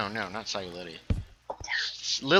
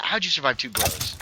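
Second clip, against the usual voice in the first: ordinary talk, nothing marked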